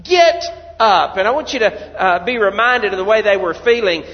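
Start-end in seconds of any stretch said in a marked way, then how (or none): 0.0-1.2 raised, higher commanding call; God's command. Acted. God telling dejected Israel to get up, commanding. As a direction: urge them to rise, firmly. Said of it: "He said".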